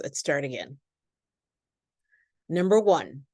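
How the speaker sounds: usual speaking voice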